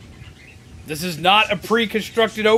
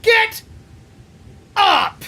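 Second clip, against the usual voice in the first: yell; 12 semitones higher than usual. Real, not acted. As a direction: shout the command at it. Yelling at the fallen Pokemon.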